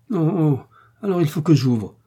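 plus aigu et plus fort, plaintif; gémir d'une voix d'aubergiste apeuré; aubergiste gémissant. A narrator's reading. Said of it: "murmura Pecker en gémissant". (aubergiste effrayé qui gémit en ouvrant)

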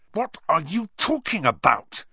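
loud, sharp angry question; angrily. Acted. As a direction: snap back angrily. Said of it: "said Harry angrily".

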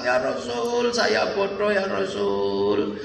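hammed-up tearful lament; crying confession. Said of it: "Nangis ini ya".

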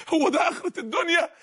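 ordinary delivery

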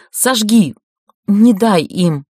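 loud frantic command; urgent desperate plea. A narrator's reading. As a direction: plead frantically. Dying woman's urgent plea.

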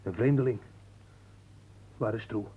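normal talking voice

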